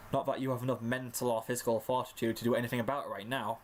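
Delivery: plain reading voice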